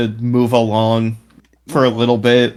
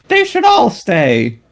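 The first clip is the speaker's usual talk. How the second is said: Jerry Springer impression; 9 semitones higher, slower than usual. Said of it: "Jerry's like".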